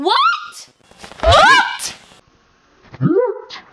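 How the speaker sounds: high, rising squeals of what; stunned disbelief. Real, not acted. Kid stunned by an Echo Dot, squealing disbelief. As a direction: shriek in disbelief.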